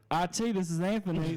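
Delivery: exaggerated southern phone greeting; southern accent. Shown as a demonstration. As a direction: answer the IT line in a thick southern accent. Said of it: "I hid my southern accent".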